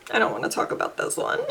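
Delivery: sighing, barely voiced, reluctant; pained sigh. Real, not acted. Sighing to viewers over a discontinued pencil. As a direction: breathe it out like it hurts.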